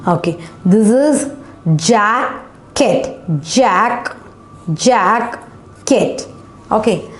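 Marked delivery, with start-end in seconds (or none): 1.8-3.2 "jacket" cut into jack and ket; split into syllables. Shown as a demonstration. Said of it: "We just divided into two syllable". 4.7-6.3 "jacket" with a break before ket; split into syllables. Shown as a demonstration. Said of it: "We just divided into two syllable".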